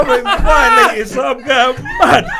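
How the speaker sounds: loud, high laughing protest; mock outrage through laughter. Real, not acted. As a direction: shout it while cracking up.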